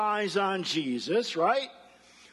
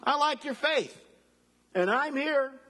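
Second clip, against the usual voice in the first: encouraging Jesus; 7 semitones higher than usual. Retold or imitated. Preacher voices Jesus encouraging Peter.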